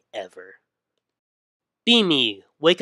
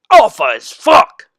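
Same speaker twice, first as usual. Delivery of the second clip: triumphant yell; 11 semitones higher, 15 dB louder than usual. Acted. Triumphant cry over tendies.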